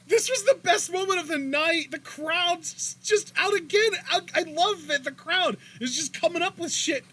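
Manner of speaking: lit up, dragging the words out; thrilled gushing. Real, not acted. Telling cohost about the best moment, elated.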